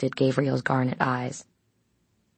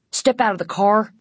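higher, forceful command; ordering; 7 semitones higher, 4 dB louder than usual. A narrator's reading. The guard orders the teens out, forcefully. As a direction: order them out with authority. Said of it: "the guard ordered".

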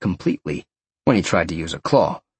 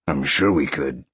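low-pitched lion voice; lion's low persuasion; coaxing inner lion; 7 semitones lower than usual. A narrator's reading. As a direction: drop low to coax as the lion.

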